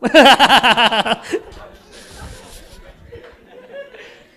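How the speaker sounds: high, loud guffaw tailing off; loud belly laugh. Real, not acted. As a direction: burst out laughing, then wheeze down.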